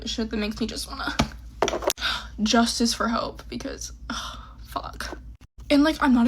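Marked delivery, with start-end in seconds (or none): 1.2-2.4 a long, heavy, exasperated sigh. Real, not acted. Upset girl sighing at her mom's punishment. 3.5-5.2 breathy whispered ugh then a curse. Real, not acted. Crying vlogger whispering a frustrated curse.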